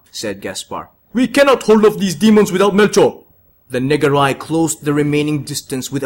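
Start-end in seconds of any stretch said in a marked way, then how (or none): 1.1-3.2 desperate wizard. Acted. Wizard despairs in battle.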